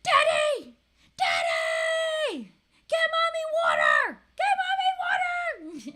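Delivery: put-on very high, full-force toddler screech; shrieked demands at the top of the lungs; angry, demanding child. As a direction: imitate a bossy kid screaming orders. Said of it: "most angriest, demanding voice".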